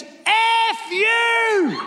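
high, mock furious shout; lady's shout. Driver curses at the pastor, high mock shout. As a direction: yell it like an angry driver. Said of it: "she goes".

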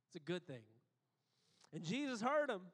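ordinary talk

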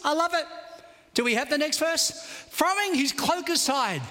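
ordinary talk

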